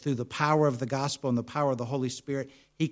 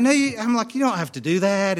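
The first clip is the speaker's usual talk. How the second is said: acted protest; 7 semitones higher, 7 dB louder than usual. Acted polite protest to rental clerk.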